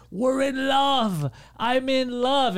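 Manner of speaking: high, long-drawn mock declaration; mock lovestruck lady. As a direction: imitate a woman wailing her love. Mimics lady protesting her love.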